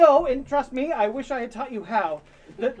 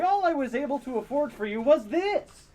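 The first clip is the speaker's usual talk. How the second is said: ordinary talk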